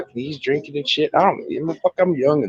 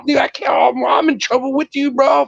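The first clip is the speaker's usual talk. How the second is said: higher, shouted mock panic, slow at first; freaked-out yell; 9 semitones higher than usual. Telling friends how he yelled at the driver, mock panic. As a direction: yell it like you just saw the wrecked tire. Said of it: "And I'm like".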